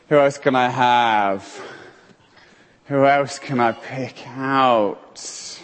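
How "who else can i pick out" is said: ordinary talk, nothing marked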